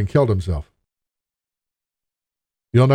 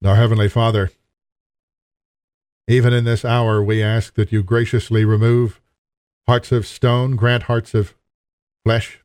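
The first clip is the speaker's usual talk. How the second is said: prayer. Acted. Pastor praying over the congregation, earnest and steady.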